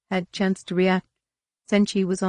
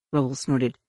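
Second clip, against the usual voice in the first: usual speaking voice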